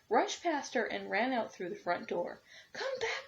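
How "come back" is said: put-on high calling old woman; the old woman calling after the runaway cookie, high; old woman crying out. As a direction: call after him, high-pitched. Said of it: "cried the little old woman".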